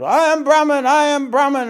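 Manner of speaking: high, loud retold shout; ecstatic screaming. Teacher retells a disciple shouting during the program, ecstatic screaming. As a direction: cry it out, lost in bliss. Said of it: "So he goes".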